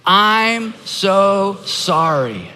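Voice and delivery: stretched words with space between; model apology. Shown as a demonstration. Pastor leading the congregation in practice, very slow and deliberate. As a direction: lead it very slowly, word by word. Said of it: "let's practice this out loud".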